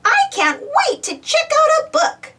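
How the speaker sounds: put-on very high eager child voice; bursting with eagerness; excited. A narrator's reading. Baby Bop eager to borrow her first book. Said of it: "says Baby Bop excitedly".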